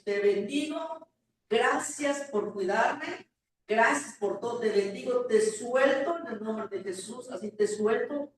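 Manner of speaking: loud, heartfelt blessing with drawn-out words; prayerful release. Retold or imitated. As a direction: declare the farewell blessing firmly. Retelling farewell blessing over dying dog. (bendición de despedida, firme y sentida)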